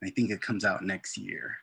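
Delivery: usual speaking voice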